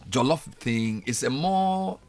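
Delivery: ordinary delivery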